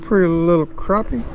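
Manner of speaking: usual speaking voice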